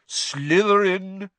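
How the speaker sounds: high, drawn-out announcement; hat's proclamation. A narrator's reading. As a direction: stretch the house name out grandly. Hat proclaims house.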